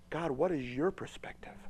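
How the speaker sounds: hushed prayer with a rising end; whispered prayer. Acted.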